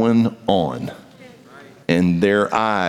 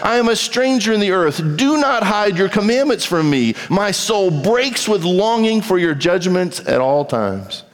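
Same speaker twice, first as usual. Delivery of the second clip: slow, raised reading of a psalm over music; scripture reading; 11 semitones higher than usual. Acted. Preacher reading scripture to the church, slow and elevated. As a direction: read the psalm to the congregation, slow and lifted.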